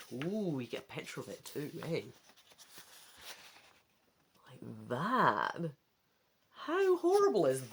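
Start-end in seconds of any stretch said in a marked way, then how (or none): none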